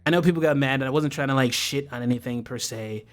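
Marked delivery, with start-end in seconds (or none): none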